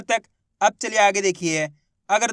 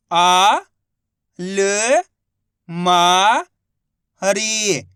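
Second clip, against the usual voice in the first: syllable-by-syllable reading demo; much slower than usual. Shown as a demonstration. Teacher showing students how to read सरकारी, slow and deliberate. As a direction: say each syllable apart, then stretch the whole word slowly. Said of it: "एक ही अक्षर का उच्चारण करके इनको पढ़ रहा हूँ".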